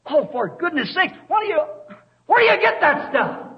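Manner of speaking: high, incredulous preaching exclamation; exasperated outcry. Real, not acted. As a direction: throw up the pitch in exasperation. Preacher exclaims at the churches' excuse.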